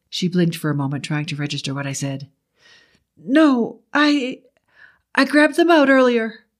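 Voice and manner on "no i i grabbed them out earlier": high woman's voice, halting; high, hesitant realization; Betty's voice. A narrator's reading. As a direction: voice a woman haltingly remembering, pitched up. Narrator voices Betty remembering the keys.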